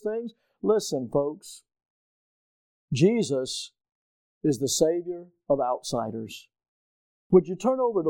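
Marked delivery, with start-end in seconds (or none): none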